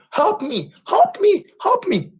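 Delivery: high, frantic cries for help, hammed up; mock panicked plea. A man fleeing the lion, mock terrified begging. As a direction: squeal it like a scared man running.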